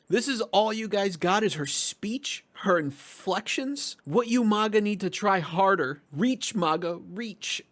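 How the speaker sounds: high, slow, whiny put-on voice; mocking commenter voice. Reading a comment scolding maga viewers, whiny mocking voice. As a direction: parody a huffy online critic. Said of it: "You mean millionaires like A O C and Bernie?".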